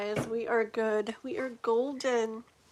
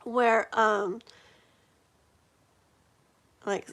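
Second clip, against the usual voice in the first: ordinary delivery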